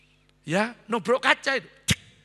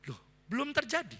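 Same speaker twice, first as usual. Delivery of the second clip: ordinary talk, nothing marked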